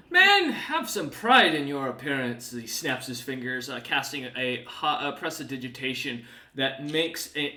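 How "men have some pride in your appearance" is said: a touch of loud, haughty scorn; disgusted Snowbeard. Acted. Demon scolds the dirty party, mildly disgusted. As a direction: sniff at their filth. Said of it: "with a look of disgust and goes".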